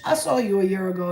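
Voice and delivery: ordinary delivery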